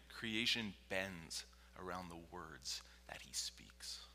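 low, slow, whispery emphasis; hushed awe. Real, not acted. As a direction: breathe it out with slow reverence. Solemn repeat in hush.

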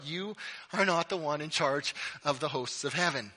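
normal talking voice

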